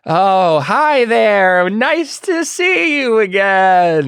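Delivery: loud, high, stretched-out greeting; drawn-out, high-pitched forced cheer; Jack. Acted. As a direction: overdo a friendly hello in a high character voice. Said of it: "the, the Jack says".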